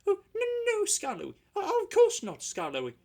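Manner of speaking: high, meek quavering reply. Acted. Scolded coaches answering meekly, high and quavering. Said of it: "quavered the coaches meekly".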